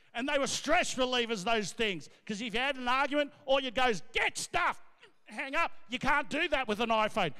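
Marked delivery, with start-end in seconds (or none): 4.1-4.8 high, sharp angry snap. Acted. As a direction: snap it before hanging up. Slamming wall phone in argument.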